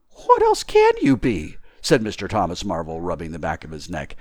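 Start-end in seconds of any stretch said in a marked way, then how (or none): 0.1-1.6 very high, loud, slow protest; incredulous tramp's raised voice; baffled tramp. Acted. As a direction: ask in high-pitched disbelief. Baffled tramp answers invisible speaker.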